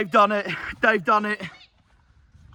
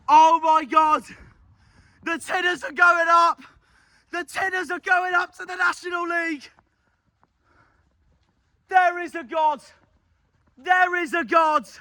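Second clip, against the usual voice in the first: jubilant; 6 semitones higher than usual. Real, not acted. Fan rejoicing that the Tinners go up, jubilant. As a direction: shout it with disbelieving joy. Celebrating promotion.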